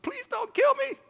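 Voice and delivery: high-pitched, pleading squeal; mock begging. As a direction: act out a terrified plea, played for laughs. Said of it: "I was begging".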